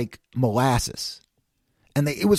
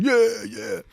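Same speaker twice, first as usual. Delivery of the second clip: higher-pitched pretend cheer; mock cheering; 6 semitones higher than usual. Fan pretending to cheer for Quince Orchard, mock cheering. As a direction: cheer half-heartedly in a raised pitch. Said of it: "we're gonna jump up and down".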